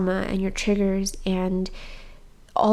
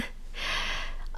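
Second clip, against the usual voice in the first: soft laugh; 9 dB quieter than usual. Real, not acted. Soft laugh after emotional moment.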